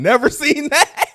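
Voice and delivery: high, laughing protest; can't get it out for laughing; laughing disbelief. Real, not acted. Teasing her about the show, laughing in disbelief.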